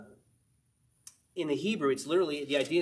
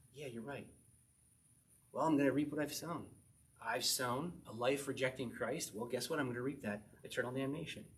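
much softer, defeated concession; resigned admission; 3 semitones lower, 7 dB quieter than usual. Retold or imitated. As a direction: let the fight drain out, admit it. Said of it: "sinner conceding to God".

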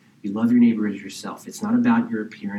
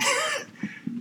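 a short high burst of laughter; 9 dB quieter than usual. Real, not acted. Preacher laughing at his own joke, high and quick.

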